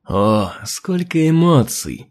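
slow, drawn-out mockery; mocking. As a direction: savour the taunt. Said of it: "Голос рептилии звучал подчёркнуто издевательски".

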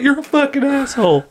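high-pitched mock child voice; little kid. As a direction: imitate an upset kid hurling an insult. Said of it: "This little kid's like".

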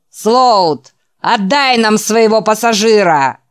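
громкий высокий выкрик-требование; выкрикни угрозу толпы с улицы; требовательный крик. Acted. Mob outside yells demand. (требовательный крик снаружи)

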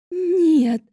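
громкий надрывный стон; отчаянно, со слезами; стон отчаяния. A narrator's reading. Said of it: "простонала она". (громкий стон отчаяния)

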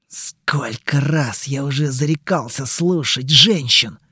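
ordinary delivery, nothing marked

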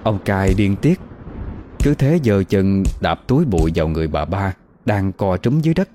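ordinary delivery

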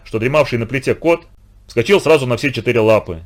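plain reading voice

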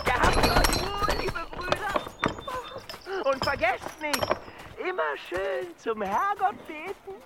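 gloating farewell. Acted.

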